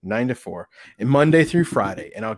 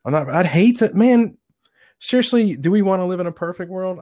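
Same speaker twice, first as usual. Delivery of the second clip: higher, louder rant; excited; 8 semitones higher, 4 dB louder than usual. Real, not acted. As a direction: push it up, emphatic and loud. Rants about a perfect world.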